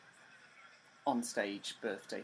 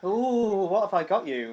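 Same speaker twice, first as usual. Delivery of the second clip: higher, louder teasing ooh; teasing excitement; 7 semitones higher, 10 dB louder than usual. Acted. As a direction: tease the surprise playfully. Teasing build-up to gift.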